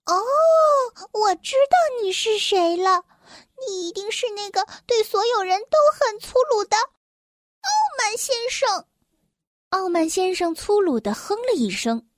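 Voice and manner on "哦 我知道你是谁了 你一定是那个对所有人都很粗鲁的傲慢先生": high-pitched little elf, knowing who this is; tiny elf voice. A narrator's reading. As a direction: speak high and small, like an elf. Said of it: "细小的声音".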